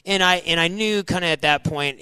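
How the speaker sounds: usual speaking voice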